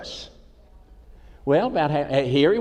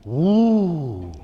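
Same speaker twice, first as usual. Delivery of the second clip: funny vocal tic. Retold or imitated. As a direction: gasp into a drawn-out "ooh". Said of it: "He had this thing going on".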